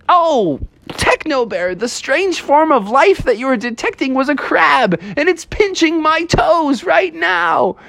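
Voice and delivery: very high-pitched hammed-up distress; pained complaint. Pinched bear complaining to Techno Bear, high pained voice. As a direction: whine it high, stretching the end.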